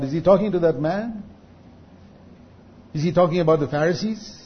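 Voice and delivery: normal talking voice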